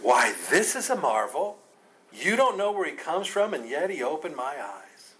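mock amazement, pitch swooping up; incredulous, sarcastic comeback. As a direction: marvel at them sarcastically. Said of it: "I love the talk about sarcasm".

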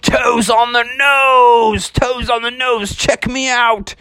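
high, drawn-out whooping boast; showing off. Acted. Father bear riding the board's edge, high boastful shout. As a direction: crow it out high like a proud surfer.

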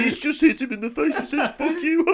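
put-on high, loud giggly kid voice; hammed-up, laughing schoolkid excitement; mock eleven-year-old. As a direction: imitate a child thrilled by a rude word. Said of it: "like, an eleven-year-old who's like".

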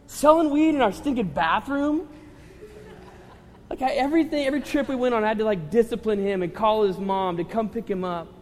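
high and loud, worked up; exasperated outburst. Real, not acted. Youth pastor recalling the trouble the boy caused, loud and exasperated. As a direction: vent the frustration.

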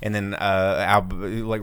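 ordinary talk

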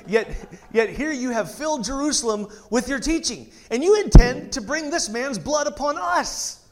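pompous official laying blame; accusing Pharisee. Acted. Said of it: "when I read for a Pharisee".

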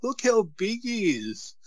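put-on high, slow child voice in wonder; awed little boy. Son telling dad how big Brock is, high and awed. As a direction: play the wide-eyed son.

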